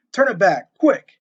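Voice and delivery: high, loud desperate begging; baffled outrage. Acted. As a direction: beg in outrage. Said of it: "begged Shaw in a baffled outrage".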